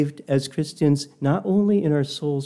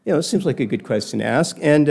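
usual speaking voice

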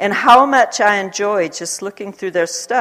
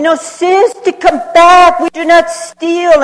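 much higher, loud pleading call; pleading shopkeeper; 11 semitones higher, 9 dB louder, slower than usual. Retold or imitated. Shopkeepers calling after the departing woman, high loud plea. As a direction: call her back desperately.

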